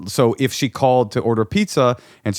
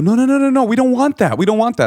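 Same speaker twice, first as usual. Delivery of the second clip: much higher and louder, alarmed no; horrified protest; 6 dB louder than usual. Retold or imitated. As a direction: cry out no in a panic.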